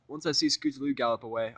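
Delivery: usual speaking voice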